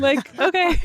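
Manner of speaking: a startled, hurt little okay; stunned okay. Retold or imitated. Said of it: "stunned reaction".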